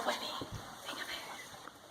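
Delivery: slow hushed whisper; a slow whisper to settle the class. Real, not acted. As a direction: breathe it slowly so nobody talks. Teacher says no talking, quiet thinking.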